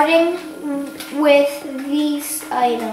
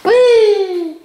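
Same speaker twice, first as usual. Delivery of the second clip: zombie; 4 semitones higher, 4 dB louder than usual. Shown as a demonstration. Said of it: "this is what a zombie sounds like".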